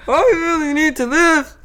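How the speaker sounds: put-on high, loud drunk hobo drawl. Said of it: "drunk hobo".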